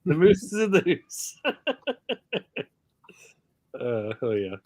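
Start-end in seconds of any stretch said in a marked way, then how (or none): 0.0-1.4 put-on raised, jokey Canadian accent, hoose for house; mock Canadian. Teasing Canadian viewers in mock Canadian accent. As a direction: ham up a Canadian moose and hoose. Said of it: "Canadian trait".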